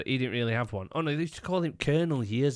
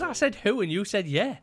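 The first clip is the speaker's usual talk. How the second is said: high, louder retort; retorting quote; 10 semitones higher, 4 dB louder than usual. Retold or imitated. Retelling arguing with Pav, high louder retort. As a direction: bounce it back pitched up.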